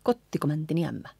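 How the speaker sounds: rapid kottiko mäntini ämmä; quick, run-together line at tempo; whole line fast. Shown as a demonstration. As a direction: rattle the line off in one go. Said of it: "and so on".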